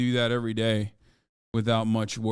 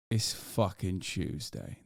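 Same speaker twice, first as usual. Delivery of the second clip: much lower, much softer, slow drawn-out announcement; low growled announcement; 6 semitones lower, 9 dB quieter, slower than usual. Pretend. Hype Tuesday bit.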